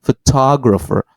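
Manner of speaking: "photographer" as pho-TOG-rapher, set apart; shifted stress. Shown as a demonstration. Example of different stress, "photographer" on second syllable. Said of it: "stressed in different place".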